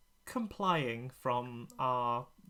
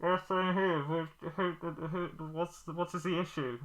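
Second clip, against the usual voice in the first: higher crackly radio voice; over walkie talkie; 4 semitones higher than usual. Acted. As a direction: answer as if through a walkie-talkie. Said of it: "in a walkie-talkie voice".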